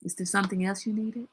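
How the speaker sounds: flat, quiet and curt; a clipped no, then a quiet dismissive question; cold polite refusal. Shown as a demonstration. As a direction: shut the boss down politely. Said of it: "See, that's how you tell your boss".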